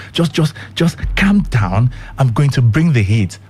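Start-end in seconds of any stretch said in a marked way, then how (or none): 0.1-1.9 slow, soothing, a bit higher; calm down. Acted. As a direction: ease them down gently and slowly. Host soothing his cold listeners.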